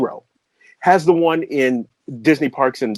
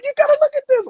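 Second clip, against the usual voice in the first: high, breathless and giddy; barely able to get the words out; gasping with joy; breathless excitement; much faster than usual. Retold or imitated.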